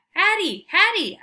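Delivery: high, loud excited calls; calling out. Acted. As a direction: yell for her up the stairs. Dog calling for Hattie.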